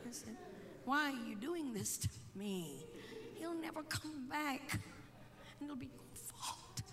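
hushed, low groan of dismay; dismayed plea. Retold or imitated. Son privately despairing over his father's visit, hushed dismay. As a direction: plead quietly under your breath.

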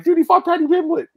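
high and loud, can't believe it; yelling the obvious at a friend; exasperated shout. Retold or imitated. Reminding his buddy who the fighter fought, high exasperated shout.

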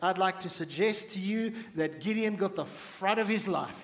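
ordinary talk

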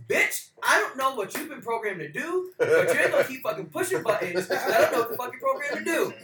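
hammed-up indignant rant; mock-outraged scolding. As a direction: tear into the android, exasperated. Acted scolding of the meddling android.

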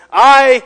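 I punched very loud and high; emphatic I. Shown as a demonstration. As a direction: blast the word I on its own. Contrasts I with you.

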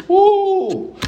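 high, loud startled cry; startled whoop. Retold or imitated. Father startled by his son, high loud whoop. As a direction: jump at a scare. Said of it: "Kellen popped out".

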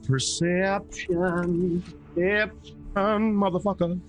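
drawn-out high whine at the roll; mock-dramatic groan. Real, not acted. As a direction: stretch the word out in exasperation. Player groans at perception roll.

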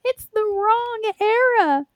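very high, slow whiny nerd voice; nerdy whiny voice. Retold or imitated. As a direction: drag it out like a fussy fan. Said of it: "I'm just like".

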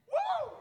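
high-pitched whoop; woo. Real, not acted. Preacher's own exclamation mid-sermon.

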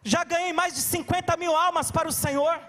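gritando, mais alto e agudo; pregação em plena força. Acted. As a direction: proclame como pregador inflamado. (pregador clama à igreja em pé, gritando)

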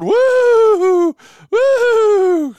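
high, loud ghostly wail; spooky ghost woo. Pretend. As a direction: hoot like a cartoon ghost. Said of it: "did I scare you a little bit".

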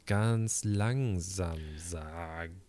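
very slow, drawn out; slow motion. Shown as a demonstration. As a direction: stretch every word slowly. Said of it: "das können wir jetzt auch".

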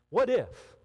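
ordinary talk

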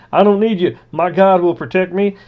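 high, loud hammed-up refusal; stubborn faithful man; mock stubborn believer. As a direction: wave off the rescue.

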